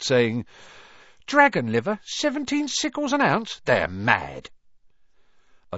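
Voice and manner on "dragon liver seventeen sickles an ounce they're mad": high, scandalised grumble; indignant shopper. A narrator's reading. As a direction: huff about the price. Shopper complaining about prices.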